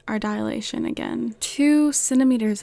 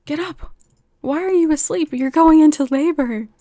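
louder, higher, urging her to wake; urgent excited call; 5 semitones higher, 7 dB louder than usual. Real, not acted. Player urging her sleeping sim awake, loud excited call.

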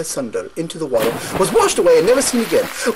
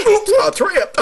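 startled oops; 13 semitones higher than usual. Acted. Goat tripping.

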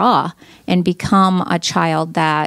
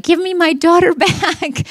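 laughing plea; 10 semitones higher than usual. Retold or imitated. Mother pleading for her daughter.

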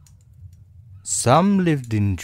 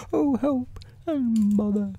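very high bear voice; high storybook bear in trouble; Pooh. A narrator's reading. Pooh stuck in the hole, high fretful voice.

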